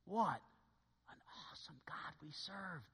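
awed whisper about God; reverent whisper. Real, not acted. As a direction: breathe it out in awe. Hushed reverent aside.

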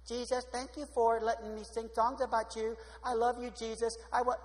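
imitating an artless little prayer; simple childlike prayer. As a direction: pray in an even, singsong recital. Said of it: "Like Noah did".

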